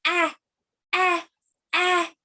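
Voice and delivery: lightly voiced reaching baby. Shown as a demonstration. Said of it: "Lift up your hand and say".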